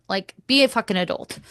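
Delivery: ordinary talk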